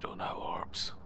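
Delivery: breathy whisper, barely voiced. Real, not acted. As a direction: drop to a whisper in the dark. Checking for orbs in the dark.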